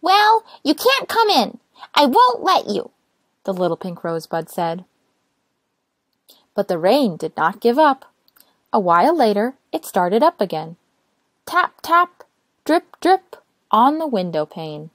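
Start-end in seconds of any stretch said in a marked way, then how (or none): 0.0-2.9 high, slow and firm refusal; little rosebud refusing to open the door, high and stubborn. Acted. 11.4-13.4 high, light tap tap, drip drip; rain sounds. A narrator's reading.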